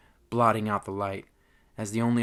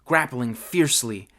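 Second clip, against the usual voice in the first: ordinary delivery, nothing marked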